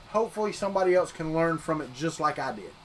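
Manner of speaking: ordinary talk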